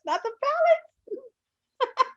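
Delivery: high, drawn-out playful lament; mock dismay. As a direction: stretch it out in high mock horror. Mock dismay at remembered floor beds.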